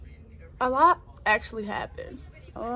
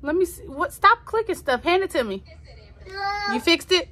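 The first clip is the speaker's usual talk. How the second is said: scolding niece; 9 semitones higher, 6 dB louder than usual. Real, not acted. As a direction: tell the little niece off, sharply. Talking to niece grabbing the iPad.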